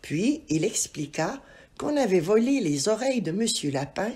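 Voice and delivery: usual speaking voice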